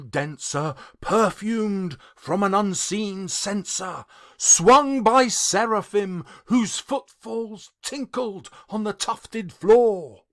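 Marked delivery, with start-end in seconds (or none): none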